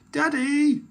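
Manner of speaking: high, loud call to Dad; squealed call for Dad's attention; child's shout. A narrator's reading. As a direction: shout excitedly like a little girl. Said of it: "shouted Peppa".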